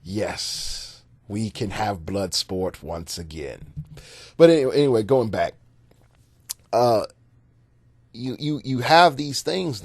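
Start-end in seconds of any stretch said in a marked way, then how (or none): 0.0-3.7 softer, low hammed-up relish; gleeful old cats. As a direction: savor it like a sinister veteran. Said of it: "All the old cats are like".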